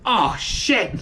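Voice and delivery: drawn-out "oh shit" of sudden realisation; mock dismayed exclamation; retold alarm. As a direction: replay the moment you hurt yourself. Re-enacts moment of cutting finger.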